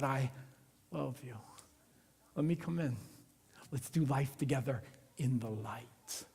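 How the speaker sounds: soft, low, tender invitation; tender God voice. Pretend. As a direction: speak it like an embrace, hushed. God embracing, speaking softly.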